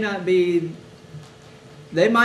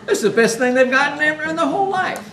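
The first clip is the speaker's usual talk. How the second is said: louder, higher laughing delight; 9 semitones higher, 6 dB louder than usual. Real, not acted. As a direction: laugh through the words with joy. Speaker laughing at the thought.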